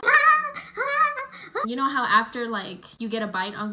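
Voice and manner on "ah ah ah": high, loud mock crying; repeated high-pitched wails of pain; imitated child's wail. Bitten girl while everyone questions her, mock wailing. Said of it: "And the girl's just crying".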